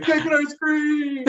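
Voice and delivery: high-pitched mock childish excitement; mock birthday boy. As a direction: beg for treats in a put-on high, drawn-out voice. Plays along as the birthday brother.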